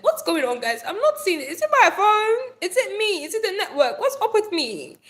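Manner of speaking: high, loud confused act with laughs; mock confusion at a missing comment; playful pretend. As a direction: play the baffled streamer. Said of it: "be like".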